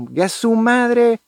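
high, slow Mexican "¡Gue su madre!" as the classic form; the classic Mexican cry "¡Gue su madre!", lifted and slow; classic Mexican exclamation. Shown as a demonstration. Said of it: "tal vez trató de hacer el clásico".

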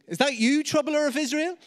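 high, loud sneering jab; mocking trash talk. Ahab sneers at Elijah on meeting him, high and mocking. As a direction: taunt him right from the first word. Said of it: "the trash talk starts".

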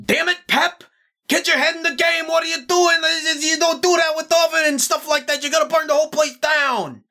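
high, scolding dad shouting at his son; angry father. Acted. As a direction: yell at the kid for nearly burning the place down. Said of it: "you hear [name] go".

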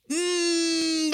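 usual speaking voice

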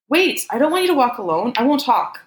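high-pitched urgent plea; desperate pleading. A narrator's reading. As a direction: beg them to wait, voice up high. Man pleads with departing lover.

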